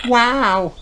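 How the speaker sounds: very high wow; breathless little awe; amazed gasp. A narrator's reading. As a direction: Kylie seeing the puddles. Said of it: "gasps Kylie".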